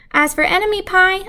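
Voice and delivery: plain reading voice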